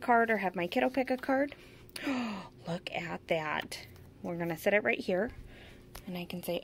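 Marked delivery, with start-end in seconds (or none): 2.1-3.8 hushed whisper of wonder. Real, not acted. Teacher whispering to a child at the game, hushed. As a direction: breathe it softly to draw them in.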